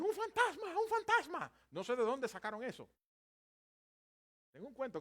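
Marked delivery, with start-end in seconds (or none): 0.0-1.5 very high, loud cry of terror; hammed-up panicked shriek; frightened scream. Frightened disciples seeing the Lord on the water, panicked scream. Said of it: "están asustados".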